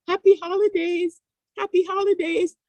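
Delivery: put-on high, singsong child greeting; imitating a four-year-old's high sing-song greeting; little girl singsong. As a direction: chirp it like a tiny girl wishing everyone well.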